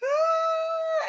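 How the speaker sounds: very high, sustained "ah"; re-enacted happy squeal. Couple just after birth, acted high squeal of joy. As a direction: let out a long overjoyed cry. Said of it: "we were just like".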